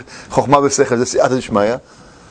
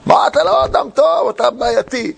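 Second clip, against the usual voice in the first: high, slower mock yell of a wife; mock shout; 7 semitones higher than usual. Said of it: "שהיא צועקת עליו". (צעקה מחוקה של אישה על בעלה)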